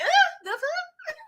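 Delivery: high slow squeal of disbelief; squeaky shocked. Retold or imitated. Dallas squealing in disbelief at the flirting, high. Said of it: "Dallas was like".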